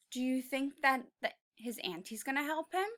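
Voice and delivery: plain reading voice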